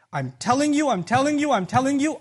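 "I'm telling you" driven home high and loud each time; insistent emphasis. Acted. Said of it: "and I emphasize again".

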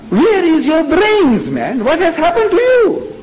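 high-pitched, incredulous scolding, drawn out at first; exasperated challenge. Real, not acted. Preacher challenging believers about their reasoning, exasperated. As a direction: demand an answer in real exasperation.

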